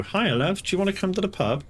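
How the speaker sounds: husband sweet-talking his wife for a lift, mock sweet; imitating a sweet-talking husband. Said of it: "where you go".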